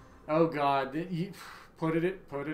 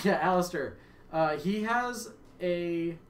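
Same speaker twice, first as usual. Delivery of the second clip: ordinary talk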